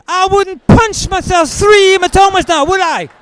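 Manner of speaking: high, loud shrill retort; loud sarcastic ex. Retold or imitated. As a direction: fire it back loud and high. Ex answering doctor sarcastically.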